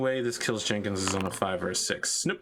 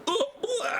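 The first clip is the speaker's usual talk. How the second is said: high pained groaning, half laughing; hammed-up moans of wounded men; wounded soldier gasping. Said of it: "You're hearing a lot of your friends going".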